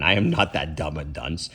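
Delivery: plain reading voice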